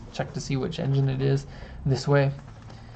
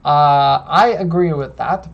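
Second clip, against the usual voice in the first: normal talking voice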